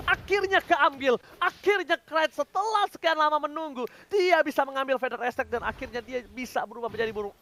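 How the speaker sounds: usual speaking voice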